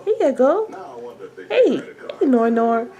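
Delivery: high, soft cooing to an infant; real high-pitched mommy voice. Real, not acted. Turns to talk to her baby.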